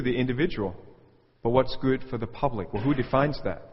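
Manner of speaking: ordinary talk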